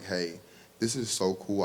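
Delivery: ordinary talk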